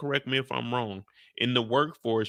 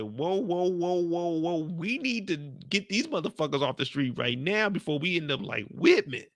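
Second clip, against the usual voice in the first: higher, panicky lightly voiced woman; imitating Jenny; 5 semitones higher than usual. Jenny panicking to the group, mock alarmed and higher. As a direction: play her alarmed, higher, rushing. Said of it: "she said".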